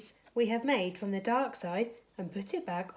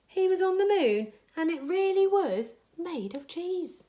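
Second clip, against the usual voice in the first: amazed excitement; 8 semitones higher, 6 dB louder than usual. A narrator's reading. Amazed discovery of cheese moon.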